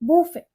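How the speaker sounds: बूफे as boo-fay; long OO in बूफे, silent T; alternative pronunciation. Shown as a demonstration. Teacher gives learners the second accepted form, alternative pronunciation. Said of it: "या हम बोलेंगे".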